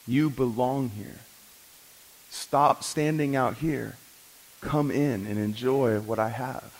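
soft, gentle pleading, slowing down at the end; a father coaxing his son to come inside, softly. Acted. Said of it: "The father's pleading with him".

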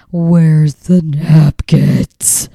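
slow, loud, desperate where's the napkins; pleading for help, dragged out; sticky-handed plea to her husband; frantic napkin plea. Retold or imitated. Frantic with jelly on hand.

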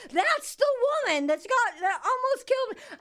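high, laughing imitation of him; mock outraged man. The man telling his wife about her, mock outrage with a laugh. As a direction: mimic him pointing her out. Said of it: "he's telling his wife".

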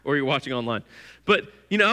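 ordinary talk, nothing marked

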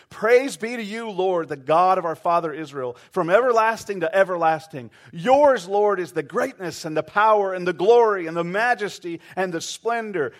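loud, high, ringing prayer; fervent praise. Retold or imitated. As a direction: proclaim it with all your energy. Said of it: "all this emotion and energy".

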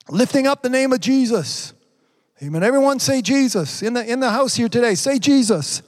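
high, emphatic exhortation; rousing preaching. Acted. Preacher asking everyone in the house to say Jesus, rousing call.

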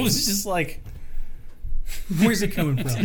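words squeezed out between laughs; laughing. Real, not acted. Laughing at breathing into mic.

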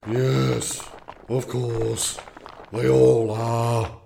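slow, loud, weary stone creature; gravelly voice. A narrator's reading. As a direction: drag it out, bored rock. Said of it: "said the warden".

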